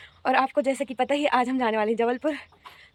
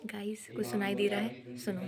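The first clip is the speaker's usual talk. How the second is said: hushed whisper to viewers; 5 semitones lower, 12 dB quieter than usual. Real, not acted. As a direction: breathe it so papa doesn't hear. Whispering so papa won't hear. (फुसफुसाकर)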